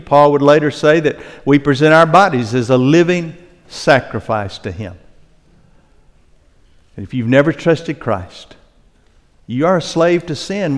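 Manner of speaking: ordinary delivery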